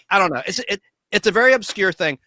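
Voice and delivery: normal talking voice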